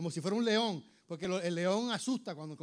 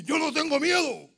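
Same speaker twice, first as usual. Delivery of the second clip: loud, raised defiant cry; strong fearless voice; 8 semitones higher, 9 dB louder than usual. Acted. Person who has lost their fear, loud strong declaration. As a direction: declare it with full force. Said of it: "esa voz fuerte".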